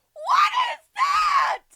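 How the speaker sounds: very high, loud outraged shrieking; drawn-out, exasperated yelling; aggressive screaming rant. Real, not acted. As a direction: scream it slowly in disbelief. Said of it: "I did sound very aggressive".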